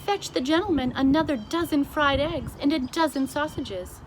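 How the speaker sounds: a hint of a gracious royal order; Queen regal command. A narrator's reading. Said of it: "showing true regal hospitality".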